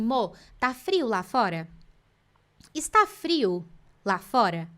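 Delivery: "Mô, tá frio lá fora?" asked, then "Está frio lá fora?" stated flat, slow and falling; slow the question into a statement; teacher contrasting question and statement tune; question versus statement. Shown as a demonstration. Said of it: "Isso aqui eu tô afirmando".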